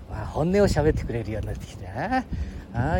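ordinary talk, nothing marked

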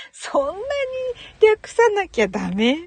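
high, drawn-out incredulous question; loud, stretched, playful protest; mock disbelief. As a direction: tease them with exaggerated disbelief. Mock disbelief at Aussie abbreviation.

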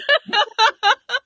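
bursting out laughing; big loud laugh. Real, not acted. Laughing at funny comment.

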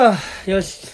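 catching breath. Real, not acted. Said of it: "気管に入った".

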